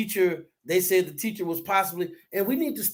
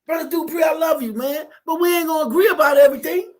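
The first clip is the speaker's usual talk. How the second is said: loud and high, fervent; emphatic; 8 semitones higher, 6 dB louder, faster than usual. Real, not acted. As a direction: hammer the point home to Brother Dupree. Emphatic address to partner.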